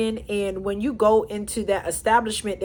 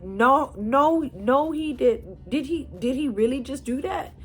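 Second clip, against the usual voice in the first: stunned disbelief; 4 semitones higher than usual. Retold or imitated. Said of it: "me in complete awe".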